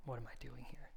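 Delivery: hushed, voiceless whisper; whispered question. Acted. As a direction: drop to a whisper after the outburst. Aside after acted outburst.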